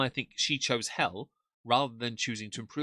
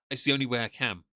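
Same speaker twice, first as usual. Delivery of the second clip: normal talking voice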